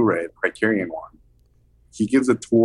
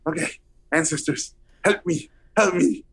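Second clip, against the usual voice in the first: high, pleading self-talk; mock desperate prayer; panicked plea; 5 semitones higher than usual. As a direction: beg the ancestors under your breath in panic. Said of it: "So I'm like".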